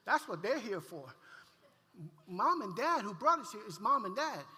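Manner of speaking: mock childish pleading; frantic child protest. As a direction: protest like a panicked kid. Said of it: "I'm like".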